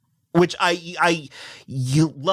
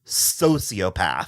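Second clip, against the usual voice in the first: deadpan; 9 semitones lower, much slower than usual. Retold or imitated. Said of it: "I was like".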